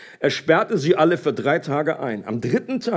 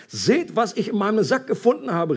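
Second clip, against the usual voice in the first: raised-pitch exclamation in story reading; calling out; 6 semitones higher than usual. A narrator's reading. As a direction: call out a discovery to the others. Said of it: "rief er".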